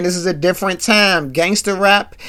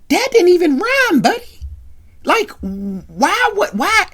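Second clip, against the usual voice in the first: incredulous; 11 semitones higher than usual. Real, not acted. Mocking [name]'s bad rhyme.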